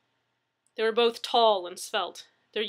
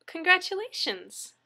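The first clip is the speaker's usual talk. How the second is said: higher, slow burst of cheer; bright congratulation; 10 semitones higher, 4 dB louder than usual. Acted. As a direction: sell the congratulations big to lift his spirits. Dispatcher cheering him up.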